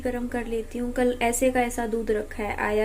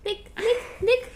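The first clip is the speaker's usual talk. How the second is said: high, bright "look, look"; 11 semitones higher than usual. Real, not acted. Distracting crying child. (बच्चे का ध्यान बँटाते हुए ऊँची आवाज़ में "देख")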